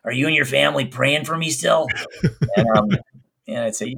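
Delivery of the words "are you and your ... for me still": raspy patient voice. Retold or imitated. Patient asking her doctor about prayers, raspy imitation. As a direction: rasp it out like a worn-down patient. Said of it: "She had this really raspy voice".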